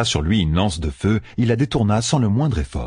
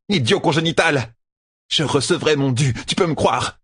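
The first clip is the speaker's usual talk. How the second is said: higher-pitched villainess rant; angry ranting; 6 semitones higher than usual. A narrator's reading. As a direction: rail at an absent rival, pitch climbing. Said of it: "éructa la traqueuse". (tirade hargneuse, voix plus aiguë)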